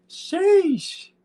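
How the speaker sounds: a long squeaky falsetto "Sheesh" that falls away. Real, not acted. A hyped player reacting with a falsetto sheesh. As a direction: stretch "Sheesh" way up high, then let it drop. Hype exclamation in voice chat.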